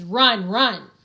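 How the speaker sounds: loud urgent yell; Max's own mind yelling at him to flee; inner scream. Acted. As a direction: scream it as a panicked thought. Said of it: "My brain screams".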